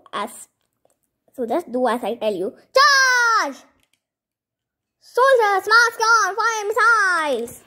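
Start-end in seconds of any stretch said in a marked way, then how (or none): 2.7-3.6 high, loud battle cry; shouted command. Acted. 5.0-7.6 high, loud military order; shouted command. Acted.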